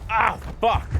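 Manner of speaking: high loud cry of pain; pained outburst. Real, not acted. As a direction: cry out at a hit. Hit again in game.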